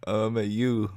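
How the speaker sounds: burped letter; burping the words. Shown as a demonstration. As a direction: burp it out. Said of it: "burping the alphabet".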